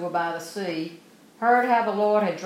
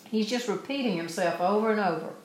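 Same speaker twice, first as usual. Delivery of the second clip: normal talking voice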